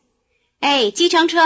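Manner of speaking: calling out loud and high for a cab; hailing a taxi. Acted. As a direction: shout to flag down a passing taxi. Clara hails an approaching cab.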